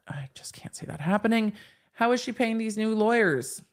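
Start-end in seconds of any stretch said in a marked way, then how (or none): 0.1-1.0 whispering. Real, not acted. Doubting aside to chat.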